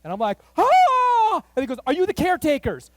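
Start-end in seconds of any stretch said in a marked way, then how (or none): 0.6-1.4 loud high startled yell; high loud gasp-yell of shock. Retold or imitated. Sees the fire crest hill. 1.9-2.9 urgent question. Retold or imitated.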